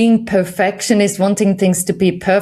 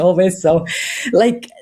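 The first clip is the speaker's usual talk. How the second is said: ordinary delivery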